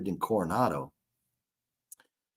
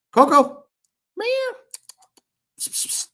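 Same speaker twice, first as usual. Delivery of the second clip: calling pet; 4 dB louder than usual. Real, not acted. Calling the cat over intercom.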